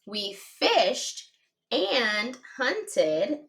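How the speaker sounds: each word dragged out very slowly; slow reread. Shown as a demonstration. Teacher rereading the sentence as students write, very slow. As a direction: stretch the words out while writing. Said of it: "rereading as we write".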